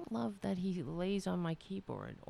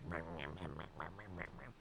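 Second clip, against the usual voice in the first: grumpy groan; 13 semitones lower, 14 dB quieter than usual. Retold or imitated. Grumpy old cat sound.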